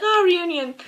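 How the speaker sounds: high, cooing delight; retold delighted reaction. Fan recalling her reaction to the cameo, high delighted coo.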